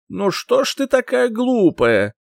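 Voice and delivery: high, slow, pensive royal voice; musing king. Acted. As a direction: muse aloud like a bored king. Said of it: "задумчиво произнёс король".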